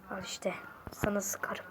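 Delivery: very soft, low whisper; whispered threat. Acted. Draws gun and threatens.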